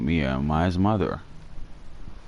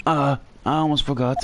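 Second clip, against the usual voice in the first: put-on higher, polite old-fashioned bellboy; Alfred voice; 8 semitones higher than usual. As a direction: play an eager, chirpy butler-type bellhop. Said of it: "So I'm gonna do the Alfred voice".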